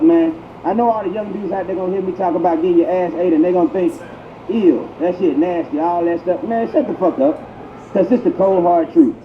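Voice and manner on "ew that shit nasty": usual speaking voice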